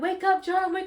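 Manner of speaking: retold shout to a sleeping husband; calling out. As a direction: snap him out of sleep.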